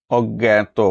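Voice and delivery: অজ্ঞাত said as "oggeto"; careful অজ্ঞাত with a gg sound; correct pronunciation. Shown as a demonstration. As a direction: double g in অজ্ঞাত, then "eto". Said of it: "প্রকৃত উচ্চারণ কোনটি".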